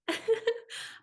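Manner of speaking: a high airless laugh of relief; high breathy laugh. Real, not acted. Overwhelmed by a stranger's kindness, a high breathless laugh. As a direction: let out a high, emotional laugh.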